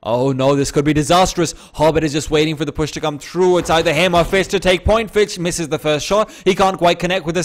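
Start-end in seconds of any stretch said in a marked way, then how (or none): none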